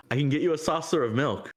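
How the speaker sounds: high, fast and eager offer; Ramses. Acted. Ramses eagerly offering the cat milk, high and fast. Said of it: "Ramses looks back up and goes".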